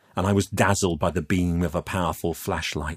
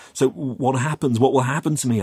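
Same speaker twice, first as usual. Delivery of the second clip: higher, worried questioning; anxious; 6 semitones higher, 4 dB louder than usual. Acted. Youth asks captor what becomes of him, anxious. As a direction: press nervously for an answer.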